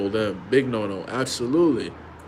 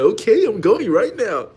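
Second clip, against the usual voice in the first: much higher, louder, slower eager yes; eager rush; 11 semitones higher, 8 dB louder than usual. Retold or imitated. Husband rushing to the store for his wife, eager. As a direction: jump to obey. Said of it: "He start be like".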